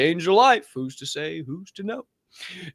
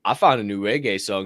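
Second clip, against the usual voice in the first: ordinary talk, nothing marked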